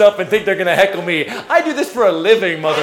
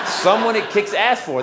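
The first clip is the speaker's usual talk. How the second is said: usual speaking voice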